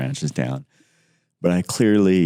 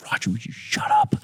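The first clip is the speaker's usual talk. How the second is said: exasperated. Retold or imitated. Snapping at whining Roger.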